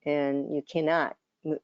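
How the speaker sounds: ordinary talk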